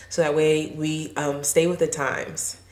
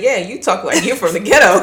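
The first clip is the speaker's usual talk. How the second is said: laughing; 4 semitones higher, 8 dB louder than usual. Retold or imitated. Sister's retort quoted with laughter.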